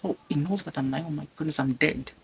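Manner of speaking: soft, fast panicked murmur to yourself; inner panic. Retold or imitated. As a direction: panic quietly inside your head. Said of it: "I told myself".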